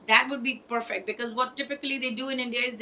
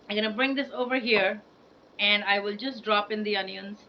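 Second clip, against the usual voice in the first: ordinary delivery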